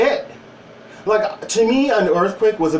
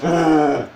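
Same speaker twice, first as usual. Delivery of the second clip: gross tongue noise. Retold or imitated. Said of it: "I'm like".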